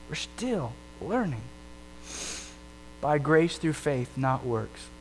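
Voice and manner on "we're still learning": drops to a near hush on the repeat; soft echo. Shown as a demonstration. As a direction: let it fade, like an afterthought. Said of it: "repeated line, softer echo".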